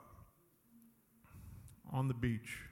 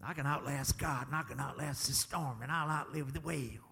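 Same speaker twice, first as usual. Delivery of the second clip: mock stubborn defiance; 4 semitones lower, 6 dB quieter than usual. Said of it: "we just so stiff-necked and so stubborn".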